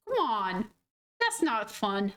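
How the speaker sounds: normal talking voice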